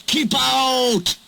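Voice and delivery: high, forceful call to keep out; shouted order. Acted. The three engines shouting at Duck to keep out. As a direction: bar the way with a sharp shout.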